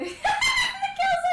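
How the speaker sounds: high, loud, slow laughing shriek; howling laughter. Real, not acted. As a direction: laugh until the words stretch out. Player cracks up mid-story.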